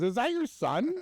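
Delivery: high-pitched mock question; imitated joker. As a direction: pitch it way up, teasing. Repeats the guy's joke.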